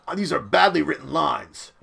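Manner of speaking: ordinary talk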